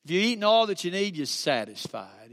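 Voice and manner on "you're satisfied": tender hush. Acted. Savoring the word satisfied.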